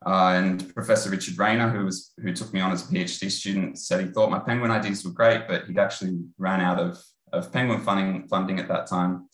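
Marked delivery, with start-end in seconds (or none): none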